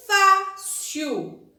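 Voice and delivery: "fácil" very slow, FÁ loud and held, then cil; stress on FÁ. Shown as a demonstration. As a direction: stretch and punch the FÁ of "fácil". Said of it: "Eu vou separar".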